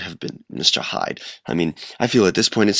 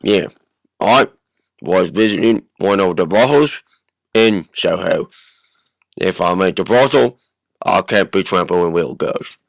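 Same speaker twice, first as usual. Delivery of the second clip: loud, halting brute; Mr. Hyde; 3 semitones lower, 5 dB louder than usual. Acted. Hyde gives his alibi to the host, loud brute voice. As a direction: give the alibi in loutish bursts. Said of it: "this is Mister Hyde".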